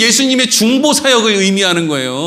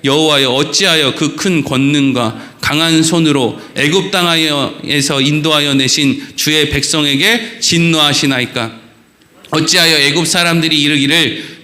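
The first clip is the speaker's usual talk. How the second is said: lower, measured Bible reading voice; formal pulpit scripture reading; 7 semitones lower than usual. Acted. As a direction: read the passage with solemn weight. Reads scripture aloud.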